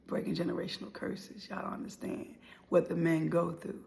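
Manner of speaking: ordinary talk, nothing marked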